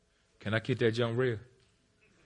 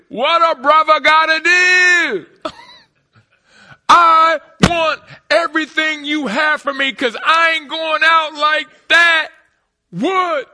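loud, high, stretched-out sermon shouting; full-force preaching; 12 dB louder than usual. Real, not acted. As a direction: preach it to the rafters.

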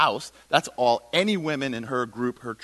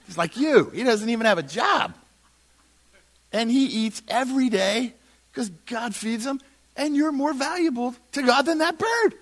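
high, lively Jesus answering disciples; animated Jesus voice; 7 semitones higher, 4 dB louder than usual. Acted. Preacher voicing Jesus to disciples, high and animated. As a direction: play Jesus breezily pointing at a bird.

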